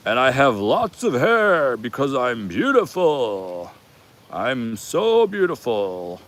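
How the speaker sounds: slow, grandiose self-adoring proclamation; drawn-out, swooping hammed-up boast; mock god voice. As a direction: proclaim it slowly like a vain deity. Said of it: "then there's the voice of".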